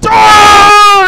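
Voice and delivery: very high, very loud drawn-out cry; long hammed-up howl of pain; agonized wail. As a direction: scream out in play-acted agony.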